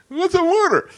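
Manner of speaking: very high, puzzled mock voice; naive recruit. Guest imitating himself asking the recruiter, high naive voice. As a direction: ask it like a clueless kid.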